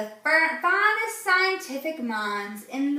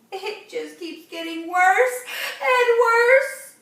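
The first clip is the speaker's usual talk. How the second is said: higher, louder, slow, crying voice; tearful cry; 9 semitones higher, 6 dB louder, slower than usual. Acted. Mother despairs over her daughter, crying. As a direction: plead through tears. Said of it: "She began to sob".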